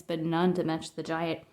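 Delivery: ordinary delivery, nothing marked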